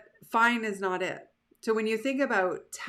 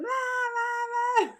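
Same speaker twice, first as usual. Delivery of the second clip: much higher mock childish wailing; hurt kid crying; slower than usual. Imitating a crying child who scraped a knee, high mock wail. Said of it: "And you're like".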